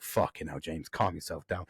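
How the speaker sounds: telling himself to calm down, hushed; calming self-talk. Retold or imitated. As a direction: talk yourself down under your breath. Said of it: "I was like".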